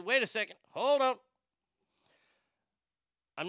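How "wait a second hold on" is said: raised, high "wait a second, hold on"; loud stop. Retold or imitated. Stopping the TV show in disbelief, loud and high. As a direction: call a sudden halt, loud and high. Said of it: "and I said".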